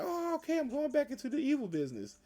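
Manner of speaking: high, sudden mock glee; a cheerful swing back to villainy; excited Joker. Joker, told his wife wants evil too, in high mock glee. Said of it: "So he's like".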